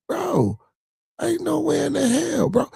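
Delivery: high-pitched disbelief, voice jumping way up; exasperated high-pitched outburst. Real, not acted. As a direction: throw your hands up in disbelief, pitched high. Exasperated rant about son.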